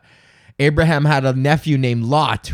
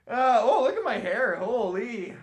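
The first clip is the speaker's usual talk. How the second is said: normal talking voice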